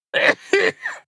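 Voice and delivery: brief high chuckle to oneself; short chuckle. Real, not acted. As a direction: let out a small amused laugh. Chuckles at own joke.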